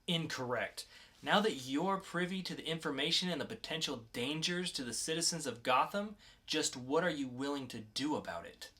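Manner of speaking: lightly voiced calm interrogator, cool and in control. Podcast host reads the mysterious agent to a captured teen, cool and controlled.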